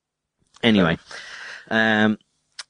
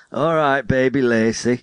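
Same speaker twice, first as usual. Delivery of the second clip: lightly voiced indulgent dad, raised pitch; pretend dad; 5 semitones higher than usual. Imagined dad answering his child, mock fatherly voice. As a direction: draw it out as a playful dad.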